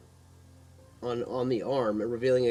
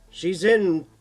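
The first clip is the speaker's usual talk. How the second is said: nurse voice; 7 semitones higher, 8 dB louder than usual. Acted. Nurse giving room number.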